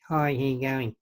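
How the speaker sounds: lightly imitated English accent; mock a footballer turning to camera in his soft voice; Beckham voice. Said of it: "all of a sudden there's like".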